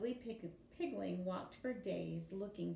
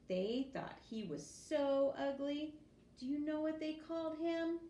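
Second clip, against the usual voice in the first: playful storytelling; 8 semitones higher, 3 dB louder than usual. Acted. Mother teasing children.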